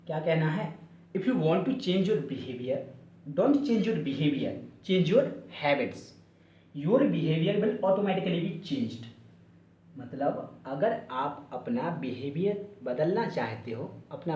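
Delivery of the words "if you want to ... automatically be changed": slow quotation. Retold or imitated. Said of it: "उनका कहना है".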